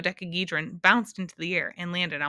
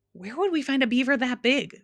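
grumbled; 7 semitones higher, 4 dB louder than usual. A narrator's reading. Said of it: "grumbled the humbug".

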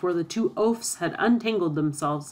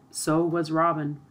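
ordinary delivery

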